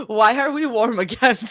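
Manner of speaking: amused, laughing disbelief. Real, not acted. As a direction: crack up while asking. Repeats line while laughing.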